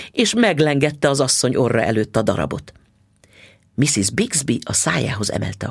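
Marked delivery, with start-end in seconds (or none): none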